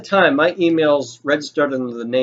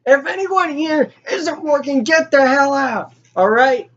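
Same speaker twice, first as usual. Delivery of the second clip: yelling boss; 10 semitones higher than usual. Retold or imitated. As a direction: clear the room with a yell. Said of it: "the manager's yelling".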